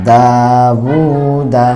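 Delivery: দাউদা with both vowels held one alif long; দাউদা টেনে পড়া; elongated recitation. Shown as a demonstration. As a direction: stretch দাউদা slowly, two long pulls. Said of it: "এক আলিফ টান হবে".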